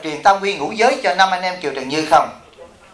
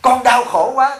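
higher, plaintive voice of a rich disciple; imitating a lament; 6 semitones higher, slower than usual. Monk voicing the millionaire confiding his pain, higher plaintive lament. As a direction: mimic the sorry millionaire's moan. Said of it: "nó từng tâm sự với chú Đại".

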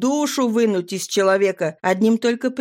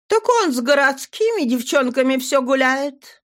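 put-on higher, earnest young girl; 6 semitones higher than usual. A narrator's reading. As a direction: speak up as a girl. Nadira voiced.

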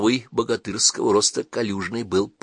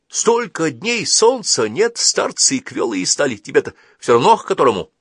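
higher, louder complaining wanderer; 7 semitones higher, 5 dB louder than usual. Acted. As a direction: whine about the sunless days. Калик жалуется. (жалобный странник, выше и громче)

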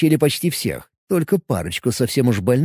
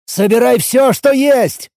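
shouting; 10 semitones higher, 6 dB louder, slower than usual. A narrator's reading. Urgent order during alarm.

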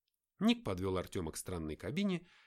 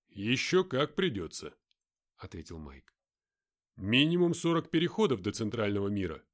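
a hint of a gruff fighter; lightly voiced tough soldier; 7 dB louder than usual. Mark answers.

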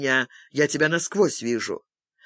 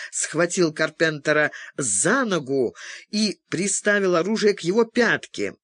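usual speaking voice

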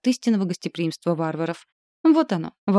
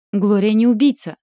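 loud; 4 semitones higher, 7 dB louder than usual. A narrator's reading. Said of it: "выступила вперёд Анника".

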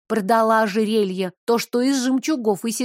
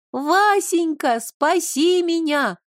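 high slow mock cry for help; mock damsel plea; 7 semitones higher, slower than usual. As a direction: squeal for rescue, hammed up.

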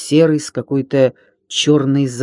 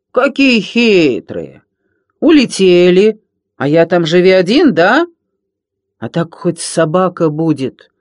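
loud, higher, whiny pirate rant; ranting villain Рыка; 3 semitones higher, 5 dB louder than usual. Acted. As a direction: sulk and shout, demanding the puppy. Villain Рыка rants about loneliness. (злодей Рыка капризно и громко орёт)

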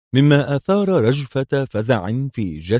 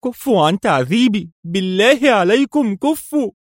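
submissive plea; 12 semitones higher, 5 dB louder than usual. A narrator's reading. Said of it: "وغمغمت في استكانة".

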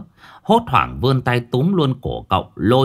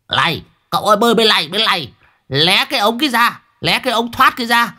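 calling out; 12 semitones higher than usual. A narrator's reading. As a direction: shout directions across water. Calling across the pond. (gọi to chỉ đường)

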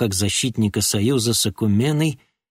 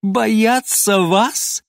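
slow, higher sneering question; 10 semitones higher, 4 dB louder, much slower than usual. Acted. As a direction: sneer it with a nasty grin. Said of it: "переспросил король ... отвратительно ухмыляясь". (ухмыляясь)

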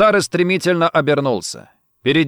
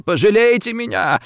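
high, wailing plea; sobbing plea; 9 semitones higher than usual. A narrator's reading. Rossetti begs the gunman for pity, high and tearful. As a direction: beg tearfully, pitch leaping up. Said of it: "плакал, всхлипывая Рассетти".

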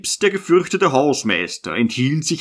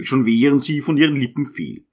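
plain reading voice

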